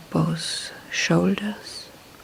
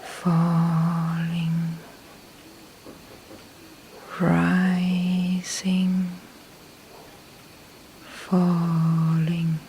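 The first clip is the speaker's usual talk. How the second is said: long, level, drawn-out syllables; mental noting; much slower than usual. Shown as a demonstration. Meditation teacher noting each breath movement, slow drawn-out monotone. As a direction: stretch each word slowly over one breath, holding the tone.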